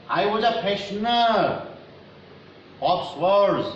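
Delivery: poem recitation. Real, not acted. Teacher reads a poem line to students, slow recitation. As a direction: recite it line by line, drawing words out.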